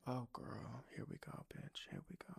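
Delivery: hushed unvoiced whisper. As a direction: whisper it like a weary aside. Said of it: "And I was like".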